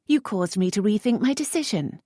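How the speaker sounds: a touch of mockery; lightly voiced teasing lady. A narrator's reading. Lisette teasing the earl, lightly arch.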